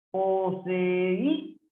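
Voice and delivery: "Poseído" drawn out, rising onto a long Í; stress on Í. Shown as a demonstration. Teacher showing a palabra grave, "Poseído" slow with stressed Í. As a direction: stretch "Poseído" syllable by syllable to Í. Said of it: "poseído es grave".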